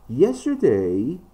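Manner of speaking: Yesterday stretched with a big pitch swing; emphasis on Yesterday. Shown as a demonstration. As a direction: lean hard on Yesterday, stretching it out. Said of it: "this is an emphasis".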